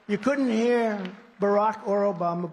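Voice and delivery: ordinary talk, nothing marked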